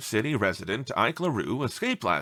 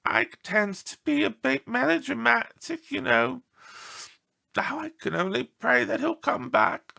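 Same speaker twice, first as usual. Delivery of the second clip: higher, fretful woman's voice; worried lady lightly voiced; upset owner; 12 semitones higher, 3 dB louder than usual. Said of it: "said a visibly upset Gertrude R. LaRue".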